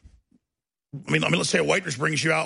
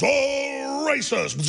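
high, swelling theatrical cry; mock-epic drawn-out exclamation; Zeus; 9 semitones higher, much slower than usual. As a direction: wind up and hurl the last word. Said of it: "what Zeus does when he throws thunderbolts".

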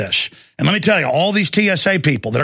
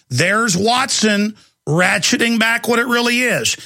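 usual speaking voice

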